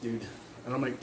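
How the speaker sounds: usual speaking voice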